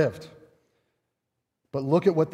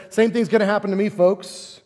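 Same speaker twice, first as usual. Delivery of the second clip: louder, higher, fast warning; Jesus's warning; 5 semitones higher, 4 dB louder than usual. Retold or imitated. Jesus warning the disciples, loud and quick. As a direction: rush it out with urgency. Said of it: "Jesus says".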